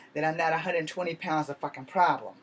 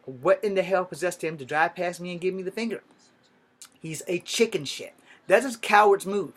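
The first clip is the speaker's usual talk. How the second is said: ordinary talk, nothing marked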